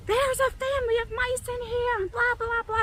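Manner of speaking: high-pitched whiny rant; retold frantic complaint. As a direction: imitate yourself fussing way up high. Retold panicked complaint to son.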